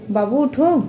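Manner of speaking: high, loud wake-up call. Real, not acted. Mother calling sleeping child to wake up, calling out.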